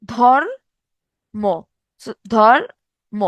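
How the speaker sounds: ধর্ম very slowly, halting after the র before ম; split for demonstration. Shown as a demonstration. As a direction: hold apart ধর and ম in ধর্ম. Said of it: "রেফ আকারে ময়ের সাথে যুক্ত অবস্থা".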